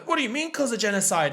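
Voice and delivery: high-pitched startled Jamie; mock alarmed question. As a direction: ask in exaggerated shock. Acting out Jamie's shocked question.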